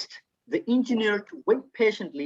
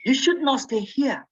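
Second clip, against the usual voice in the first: acted-out warning in a higher voice; mildly frightened warning; 7 semitones higher than usual. Woman warning Hadley to leave, higher mildly frightened voice. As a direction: raise the pitch for a mock urgent warning. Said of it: "afraid of something".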